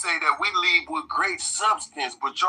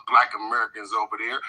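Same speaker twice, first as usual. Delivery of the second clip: normal talking voice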